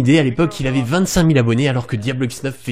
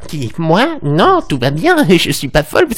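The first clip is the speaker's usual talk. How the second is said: higher, louder mock-crazy second self; schizophrenic alter ego; 4 semitones higher, 4 dB louder, slower than usual. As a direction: protest as a second personality, hammed-up. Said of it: "voire schizophrène".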